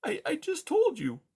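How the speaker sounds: high, small pleading mouse voice. Acted. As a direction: plead with him, scared. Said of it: "Poppy pleaded".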